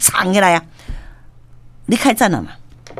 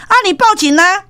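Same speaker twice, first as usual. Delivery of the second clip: defiant taunt; 9 semitones higher than usual. Retold or imitated. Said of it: "这行成讲".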